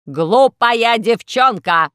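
robot yelling at Alice; furious shout. Acted. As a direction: bellow it so the tyrants hear. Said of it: "закричал робот так, что ... все тираны услышали".

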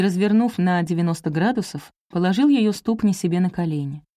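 plain reading voice

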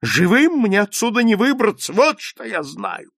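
high, loud despairing certainty; shouting. A narrator's reading. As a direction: cry out your doom. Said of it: "закричал швед". (отчаянный громкий крик)

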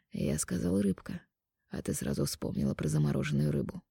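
soft, low man's voice; quiet intimate male lover. Acted. Mark teases.

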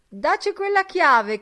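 high, loud demand; calling after someone. A narrator's reading. Mulligan to Stephen as he leaves, loudly calling for the key.

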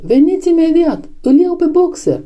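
high, urgent cry of alarm; alarmed shout. A narrator's reading. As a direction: raise the alarm in a high, strained call. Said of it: "țipă el". (strigăt de alarmă, pe ton înalt)

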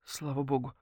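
soft, relieved exhale; quietly relieved; sigh of relief. A narrator's reading. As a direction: let the tension go, very gently. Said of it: "вздохнула Наташка с облегчением".

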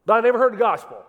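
loud, fast mock protest; mock shout. Preacher shouting a skeptic's complaint, loud.

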